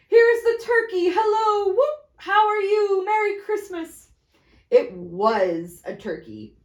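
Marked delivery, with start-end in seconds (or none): 0.1-4.1 high, loud whooping greeting; joyful shouting. Acted. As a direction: burst out in delight. Scrooge exulting at arrival.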